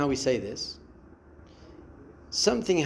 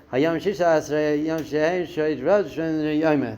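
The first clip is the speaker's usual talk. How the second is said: mindless rushed recitation of the count; hurried, mechanical counting; rote rattling off. Shown as a demonstration. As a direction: rattle the words off without meaning them. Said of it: "So don't just say".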